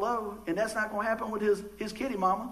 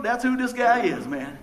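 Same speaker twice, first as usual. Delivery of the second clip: ordinary talk, nothing marked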